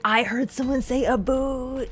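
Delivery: hammed-up Canadian accent with a drawn-out oo; exaggerated Canadian accent. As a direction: imitate a put-on Canadian accent, stretched out. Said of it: "someone was like".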